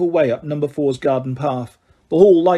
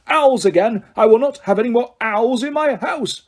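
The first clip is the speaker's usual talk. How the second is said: furious uncle; 8 semitones higher than usual. Acted. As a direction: bellow the protest in fury. Vernon raging about owls.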